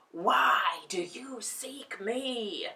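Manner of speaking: slow whispered hiss; hissing fire. A narrator's reading. Ball of fire hisses at the lion, slow. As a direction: hiss it slowly. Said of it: "hissed the ball".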